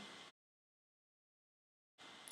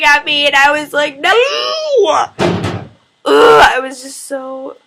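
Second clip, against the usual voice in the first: mortified wail; 5 semitones higher, 12 dB louder than usual. Real, not acted. Band staring at her.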